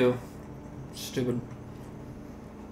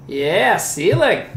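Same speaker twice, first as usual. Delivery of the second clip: drawn-out ceiling; 4 dB louder, much slower than usual. Pretend. Mock meme voice.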